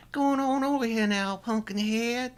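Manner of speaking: high, drawling mock Georgia accent. Cohost parodying movie accents in a hammed-up Georgia accent. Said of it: "Georgia accent".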